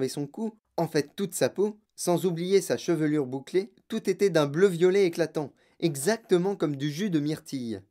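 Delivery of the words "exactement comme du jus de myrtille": ordinary delivery, nothing marked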